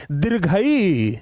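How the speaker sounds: दीर्घई with a slow, long-held ee at the end; long i demo. Shown as a demonstration. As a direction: draw out the final ई in दीर्घई. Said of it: "दीर्घीकार बोलने में ज्यादा समय".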